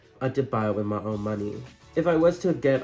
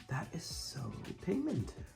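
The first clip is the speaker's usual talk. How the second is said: awed gasp; 5 semitones higher, 11 dB quieter than usual. Real, not acted. Amazed at pigment.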